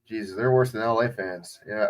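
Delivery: ordinary delivery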